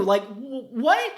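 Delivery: incredulous. Real, not acted. Incredulous reaction.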